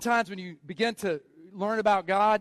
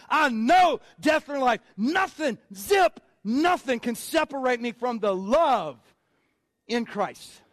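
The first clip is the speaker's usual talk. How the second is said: unglued Paul rant; slower than usual. Retold or imitated. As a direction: let it rip like you've come unglued. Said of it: "Paul came unglued".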